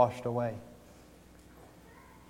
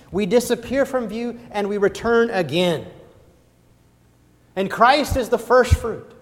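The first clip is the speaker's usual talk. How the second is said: louder, higher preaching at full force; rising preaching; 8 semitones higher, 5 dB louder than usual. Acted. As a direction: build to a ringing pulpit climax.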